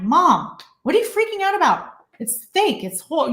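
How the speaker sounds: high-pitched retold protest to mom; incredulous protest. Daughter telling mom on set it's fake, incredulous. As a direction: push back at a fussing mother, pitch jumping up.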